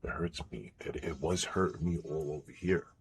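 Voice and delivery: ordinary delivery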